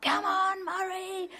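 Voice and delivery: high, loud fan's yell; shout. Acted. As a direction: yell it out like a fan at Wimbledon. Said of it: "you shouldn't really shout".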